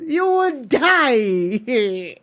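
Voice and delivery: slow, heavy you would die; mock-grave slow warning; drawn-out warning. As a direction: stretch every word out. Dramatic slowed warning.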